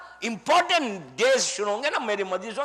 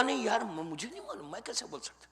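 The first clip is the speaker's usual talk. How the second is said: retold angel's reply, dropping much softer; hushed disclaimer; 3 semitones lower, 14 dB quieter, faster than usual. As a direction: voice an angel quietly backing away from answering. Acting Mikail admitting he cannot answer.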